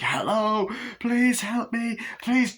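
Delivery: high, drawn-out begging; mock desperate plea. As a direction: imitate a victim begging neighbours for help. Victim begs at neighbour's house.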